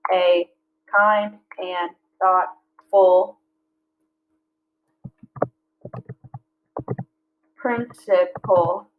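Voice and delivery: very slow, beat by beat; slow syllable-counting read; counting syllables aloud. Shown as a demonstration. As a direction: count each syllable as you say it. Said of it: "So how many is that?".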